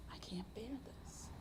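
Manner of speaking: unvoiced whisper, nearly no sound; despairing whisper. Hospital patient to herself in despair, a faint whisper. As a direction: breathe it out, defeated.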